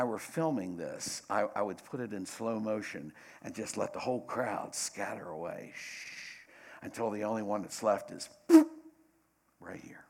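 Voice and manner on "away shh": hushing shh. Retold or imitated. Crowd scatters in slow motion.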